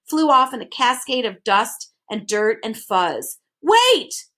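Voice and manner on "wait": high, loud call after him; shout. A narrator's reading. Milo calling after the departing bird, shouting. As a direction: yell to stop the bird leaving. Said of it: "shouted Milo".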